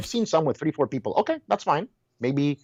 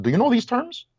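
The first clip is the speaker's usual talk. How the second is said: high, louder pointed question; challenging question; 11 semitones higher, 3 dB louder than usual. Retold or imitated. Retold question to a nurse.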